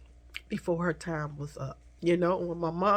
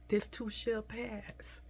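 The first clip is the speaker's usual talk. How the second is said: This too shall pass said softer and slowly, fading; soft, slow repeat; 4 semitones higher, 7 dB quieter, slower than usual. Retold or imitated. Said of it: "she always say".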